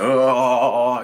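loud mock crying howl; imitated exorcism wail. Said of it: "it felt like I was getting an exorcism".

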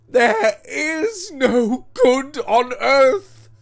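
high, slow, wailing shout; maddened despair. A narrator's reading. Husband gives himself up to the devil, maddened despairing shout. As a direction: cry out in despair, building to a full shout. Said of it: "maddened with despair".

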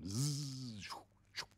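buzzing razor noise made with the mouth. Retold or imitated. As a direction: mimic an electric razor whirring. Imitating the electric razor's buzz.

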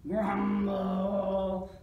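loud, drawn-out grumble; spooky grumble. A narrator's reading. Reader sounding the noise in the haunted house, rumbling. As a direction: stretch it out like a rumbling monster.

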